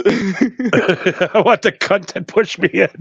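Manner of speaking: slow, laughing repeat of a punchline. Real, not acted. Co-host repeating the Australian joke's punchline, laughing. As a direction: savor the punchline through real laughter.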